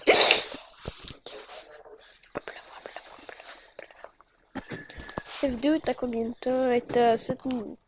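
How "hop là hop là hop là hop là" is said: hushed whisper. Real, not acted. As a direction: breathe the words out silently. Sneaking quietly in game.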